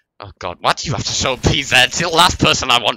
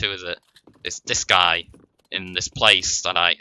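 ordinary talk

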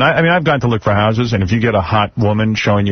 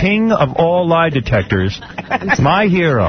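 raised, ceremonial showman's voice; mock-grand announcer; 4 semitones higher than usual. Host hails his hero lie detector, mock-grand announcer. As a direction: hail the champion with pitch lifted.

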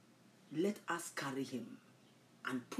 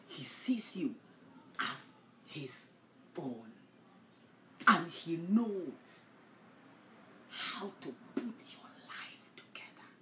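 whispered assurance; 4 dB quieter, slower than usual. Real, not acted. Intimate assurance of God's care.

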